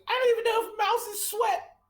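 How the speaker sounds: high-pitched nervous mouse voice; flustered mouse. Acted. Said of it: "bats sweat off of his brow and goes".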